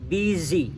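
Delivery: "busy" said as bizi in English; "busy" read the English way, bizi; English pronunciation. Shown as a demonstration. Scene: teacher showing his class the English busy. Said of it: "Itu bacanya I".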